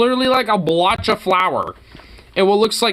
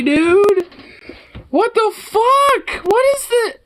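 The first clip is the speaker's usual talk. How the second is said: much higher and slow; recoiling from the friend's burger with a high, drawn-out cry; rising horror; 12 semitones higher, 3 dB louder, slower than usual. Real, not acted. Let it climb into a squeal.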